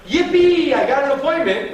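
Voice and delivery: high, loud mock yippee; hammed-up cheer of someone celebrating too soon; mock celebration. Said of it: "Instead of just going".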